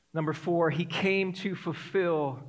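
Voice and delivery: normal talking voice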